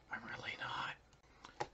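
a soft unvoiced whisper under the breath; whispered aside. Real, not acted. Creator whispering to viewers that he isn't ready.

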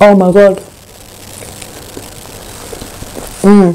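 muffled mouthful murmur; eating while talking; chewing with mouth full. Real, not acted. Seen 0: Holds kebab wrap at face, chewing between bites throughout the labelled words.

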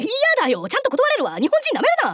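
exasperated straight-man outburst; indignant refusal. Acted. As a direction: refuse outright, all worked up.

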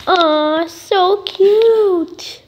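drawn-out coo, rising high on the last word. Pretend. Player showing off the kitten, cooing. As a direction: melt over the cute kitten.

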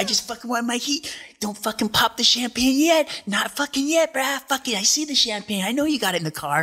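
high-pitched, insistent imitation of a rant; heated mimicry. Andy stopping the champagne after winning his heat, heated high rant.

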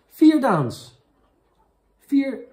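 loud, high, excited call. Real, not acted. Streamer amazed by a gift from Daan, excited. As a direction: shout it out in amazement.

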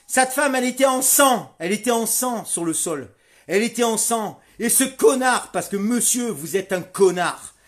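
loud, high, shouting insults; real fury at the officer; furious rant. Real, not acted. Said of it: "Tiens, je suis énervé". (tirade furieuse, crie fort et aigu contre le gendarme)

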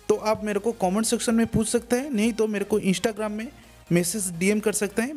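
normal talking voice

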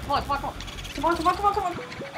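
high, breathless-paced string of pleas; tense urging. Real, not acted. Player trying to catch both boos, high tense urging. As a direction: urge it on, voice climbing.

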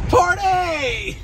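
very high, drawn-out party cry; excited whoop. Real, not acted. Whoops at the idea of liquor.

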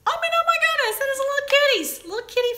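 high, gasping excitement; delighted squeal. Real, not acted. Vlogger discovering kitty faces on the toy set, delighted.

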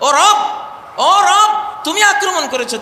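loud, high desperate appeal; crying out to God. Acted. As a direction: call out to the Lord at full voice. Acts out desperate cry to God. (রবের কাছে চিৎকার করে আকুতি)

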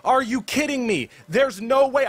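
usual speaking voice

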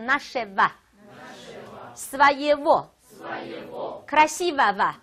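very slow, spelling-out teacher voice; slow drawn-out reading. Shown as a demonstration. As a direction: drag out each ending. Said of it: "teacher drawls endings".

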